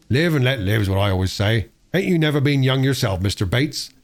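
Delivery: a lightly voiced old labourer; easygoing old worker teasing his boss; old workman. Old workman teasing the foreman, lightly voiced, easygoing.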